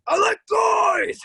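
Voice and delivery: loud, slow, insistent shout; over-the-top loud announcement; shouted declaration. Retold or imitated. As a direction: yell it to convince yourself. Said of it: "I want you all to know that I like guys".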